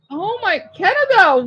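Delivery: ordinary delivery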